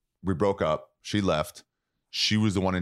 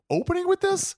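much higher, stunned disbelief; incredulous; 3 dB louder than usual. Retold or imitated. Audience at a Thanksgiving set, shocked and incredulous. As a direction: gasp it out like you can't believe the opener. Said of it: "everyone else just going".

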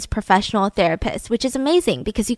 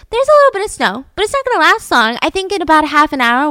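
very high, louder chirpy report; optimist; 6 semitones higher, 6 dB louder, faster than usual. Retold or imitated. Optimist reporting the weather back, high hopeful mock voice. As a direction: pipe up high, making light of it. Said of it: "If you sent an optimist".

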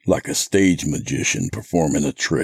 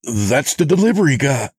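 high-pitched urgent exclamation; crying out; 11 semitones higher, 4 dB louder, faster than usual. A narrator's reading. Narrator identifies the delivery guy, crying out. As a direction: cry it out in alarmed recognition. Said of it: "I cried".

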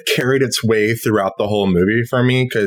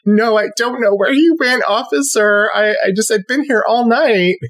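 high-pitched mock distressed wife; hammed-up innocent wife, pitched high; tearful wife act; 9 semitones higher than usual. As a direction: play the weepy wife pleading innocence to police. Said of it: "the wife in a giant fur coat, like, dabbing her eyes".

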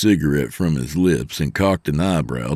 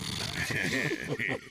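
short soft unvoiced laugh; acted laughter; 6 semitones higher, 14 dB quieter than usual. Truckers amused by another quip, laughing. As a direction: laugh softly at the joke.

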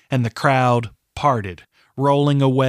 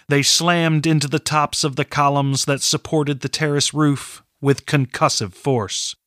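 plain reading voice

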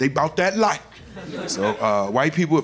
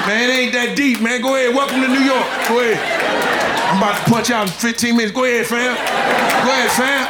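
bored New York guard; 4 semitones higher than usual. Retold or imitated. Lax New York security guard.

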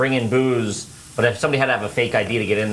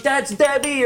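hyped Philly guy; 14 semitones higher, slower than usual. Retold or imitated. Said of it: "his boy's like".

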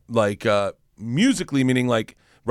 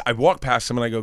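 ordinary talk, nothing marked

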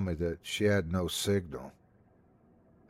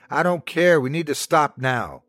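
raised, insistent outburst, starting high and loud; voice growing stronger; 5 semitones higher, 5 dB louder than usual. A narrator's reading. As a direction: put your foot down forcefully. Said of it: "Lena's voice grew stronger".

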